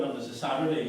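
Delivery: ordinary talk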